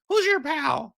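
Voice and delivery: very high squeaky cartoon mouse; tiny falsetto mouse voice; Mickey Mouse voice. Shown as a demonstration. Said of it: "I can do, uh, Mickey".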